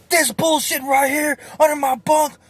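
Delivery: high-pitched mock-furious holler; imitated mad yelling. Cellmate finds something under his bunk, high angry yelling. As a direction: rage like a cellmate demanding the culprit. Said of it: "he's getting so mad".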